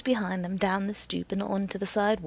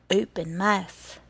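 ordinary delivery, nothing marked

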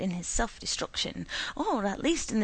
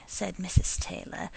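plain reading voice

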